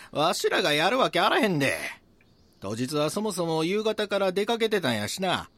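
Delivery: loud, high Kansai protest; indignant denial. Acted. Denies being the culprit.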